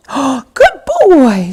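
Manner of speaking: slow, high, pleased praise; delighted praise. Real, not acted. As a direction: reward him with delight.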